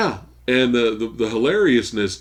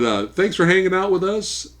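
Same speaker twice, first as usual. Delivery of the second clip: usual speaking voice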